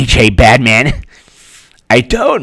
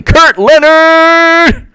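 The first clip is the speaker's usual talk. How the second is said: drawn-out high call; much slower than usual. Retold or imitated. Calling out a name.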